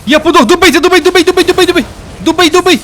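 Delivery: high, loud frantic shouting; frantic yell. Real, not acted. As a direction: yell at the allies in real panic to finish it. Dying, begging allies to finish boss.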